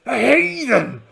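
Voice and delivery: loud pirate rebuke, rising on heathen; mock scolding. Captain scolding his first mate, hammed-up indignation. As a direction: scold the lad with mock outrage. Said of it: "clip him round the ear and say".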